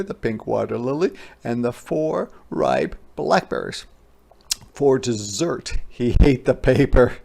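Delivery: plain reading voice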